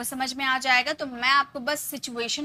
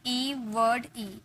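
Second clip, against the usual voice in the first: slow pattern drill; 3 dB quieter, much slower than usual. Shown as a demonstration. Teacher slowly spelling the pattern for students. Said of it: "ये सारे words आप notice कर रहे होंगे".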